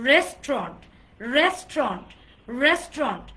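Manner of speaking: রেস্টুরেন্ট as a loud two-syllable "RES-tront"; silent U. Shown as a demonstration. Teacher continuing the class drill, loud silent-U pronunciation. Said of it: "not রেস্টুরেন্ট".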